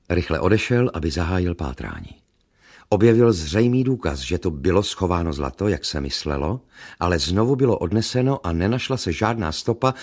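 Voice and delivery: usual speaking voice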